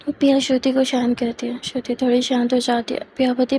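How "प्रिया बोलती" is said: ordinary delivery, nothing marked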